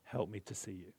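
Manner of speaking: soft, hushed inner prayer; gentle whispered-down thanks; quiet prayer. Shown as a demonstration. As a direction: pray it under your breath. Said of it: "in your heart, say".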